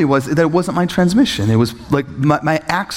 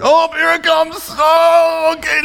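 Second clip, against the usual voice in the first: very high, drawn-out mock wail; hammed-up dread, high-pitched; bracing for bad news; slower than usual. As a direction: brace for the blow in a high squeal. Acts out dreading the bill.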